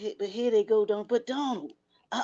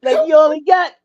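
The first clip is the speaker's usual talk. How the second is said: laughing; 5 semitones higher, 10 dB louder than usual. Real, not acted. Teasing about counting days.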